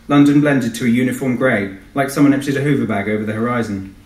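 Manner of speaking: ordinary delivery, nothing marked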